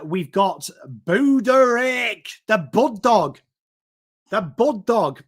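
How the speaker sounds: slow, loud shout of "the Bud Dog"; hype announcement. Real, not acted. Host introducing the next draft pick to listeners, hyped shout. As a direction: bellow the nickname like a ring announcer.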